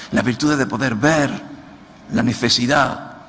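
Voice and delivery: más agudo y más fuerte, predicando con fuego; predicación enardecida. Acted. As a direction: arenga a los hermanos con fervor. Preaching at full force. (predicador exhortando a la iglesia, con fuerza)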